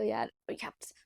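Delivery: breathy hushed whisper. Real, not acted. Vlogger hushes herself after her brother complains, whispering. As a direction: drop to a whisper so brother won't hear.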